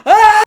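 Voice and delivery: sudden loud high yelp; startled yelp. Real, not acted. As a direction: jump at a surprise. Startled when the light goes off.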